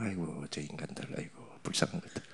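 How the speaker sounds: hushed pitying sigh; mock pity. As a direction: murmur it like pitying poor souls. Mocking pity for atheists. (불쌍하다는 듯 속삭이는 말투)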